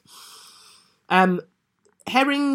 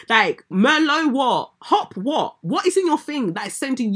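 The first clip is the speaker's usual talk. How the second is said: incredulous mocking rant; 6 semitones higher, 3 dB louder than usual. Real, not acted. Mocking the drunk excuse.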